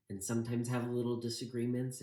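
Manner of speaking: usual speaking voice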